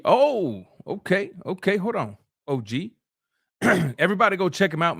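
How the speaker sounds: ordinary talk, nothing marked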